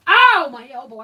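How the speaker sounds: sudden loud, high cry of pain; pained yell. Real, not acted. Owner hurting elbow beside her dog, pained yell. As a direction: yelp it out at a knocked elbow. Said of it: "I didn't mean to yell at you".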